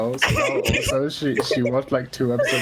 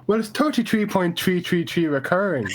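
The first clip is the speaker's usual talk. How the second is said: "thirty-three" said as "tirty-tree", raised and amused; Irish accent; 8 semitones higher than usual. Retold or imitated. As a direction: mimic the teacher, th turned to t. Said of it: "she couldn't pronounce T H's".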